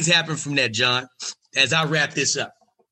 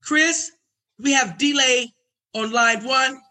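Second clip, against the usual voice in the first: much higher, sing-song office voice; high receptionist announce; 11 semitones higher, slower than usual. Retold or imitated. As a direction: announce the call politely. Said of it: "And I hear".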